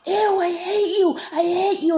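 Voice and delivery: retold scream. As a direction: scream it at the ghost in the dream. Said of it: "And then I'm screaming".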